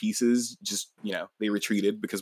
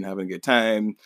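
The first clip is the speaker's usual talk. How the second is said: normal talking voice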